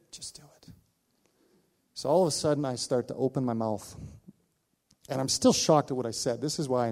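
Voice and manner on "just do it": a bare whisper; whispered urging. Retold or imitated. Inner voice pushes pastor to speak, whispered. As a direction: nudge him quietly to act.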